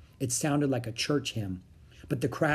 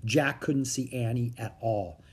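usual speaking voice

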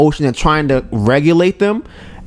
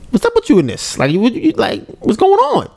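puzzled question; 9 semitones higher than usual. Retold or imitated. Retold confusion at wife in car.

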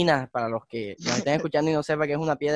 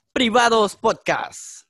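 higher, louder, slow show-name call; podcast announcer; 6 semitones higher, 5 dB louder, much slower than usual. Acted. Plugging their own podcast, a drawn-out announcer call. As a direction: announce the show's name with a flourish.

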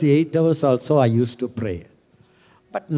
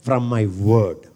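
Holy Spirit voice. Acted. Preacher voicing the Holy Spirit, a commanding voice. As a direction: speak as the voice of God, commanding. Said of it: "Holy Spirit voice".

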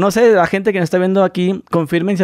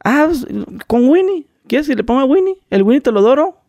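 put-on higher, singsong northern Mexican accent; 8 semitones higher than usual. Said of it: "en Mexicali, o sea, en el norte". (acento norteño mexicano, agudo y cantado)

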